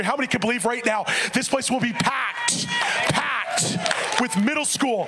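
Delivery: rising sung preaching, building up; half-sung sermon build. Acted.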